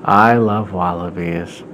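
slow, drawn-out daydreamer; zoned out and slow, a bit low; not paying attention. Acted. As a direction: say it absently, dragging the words. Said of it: "he's not paying attention".

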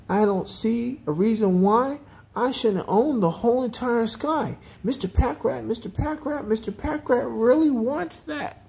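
high-pitched greedy character voice; greedy Mister Packrat, insistent. A narrator's reading. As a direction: demand it high and self-important.

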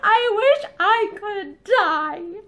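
slow, weeping words; bitter sobbing. A narrator's reading. Linda in despair to Barbara, sobbing. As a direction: sob it out, barely holding words. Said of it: "Linda fell to bitter sobbing".

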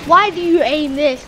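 high, loud gamer complaint; loud protest. Real, not acted. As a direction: burst out in protest at an opponent. Complains loudly at the game.

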